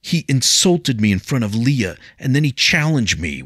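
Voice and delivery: high, loud protest; indignant protest. A narrator's reading. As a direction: justify yourself hotly. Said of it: "William protested".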